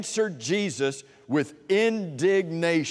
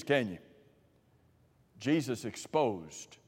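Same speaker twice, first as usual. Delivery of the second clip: normal talking voice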